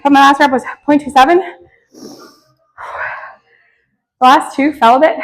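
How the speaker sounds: winded, pushing words out between breaths. Real, not acted. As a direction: speak while still catching your breath. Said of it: "I'm very out of breath".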